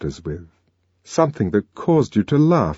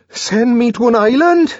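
much higher, louder shocked cry; panicked disbelief; 6 dB louder than usual. A narrator's reading. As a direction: shriek it in horror. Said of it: "violent and unseemly activity".

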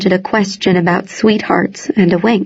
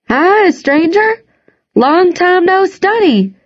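high, slow friendly call; cheerful call; 10 semitones higher than usual. A narrator's reading. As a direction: call out cheerfully while waving. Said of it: "Wash called".

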